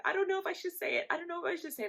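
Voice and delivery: high, quick sing-song repetition; imitated sister. Sister unsure about telling mom, high quick imitation. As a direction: dangle the secret in a high voice.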